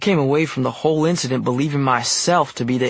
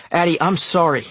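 higher, urgent pleading; raised-voice plea; hollered apology; 5 semitones higher, 3 dB louder than usual. A narrator's reading. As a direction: call after them, begging them to stop. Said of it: "I hollered".

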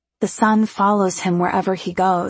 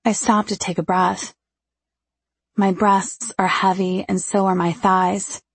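ordinary delivery, nothing marked

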